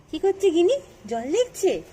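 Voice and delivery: high, loud cooing question. Real, not acted. Cooing to little Gini. (উঁচু গলায় আদুরে প্রশ্ন)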